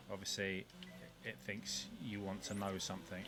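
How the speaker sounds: usual speaking voice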